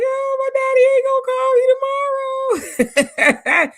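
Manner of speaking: high, slow, loud sobbing imitation of herself; imitated crying. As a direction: re-enact the tearful realisation, voice cracking high. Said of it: "I cried and I cried".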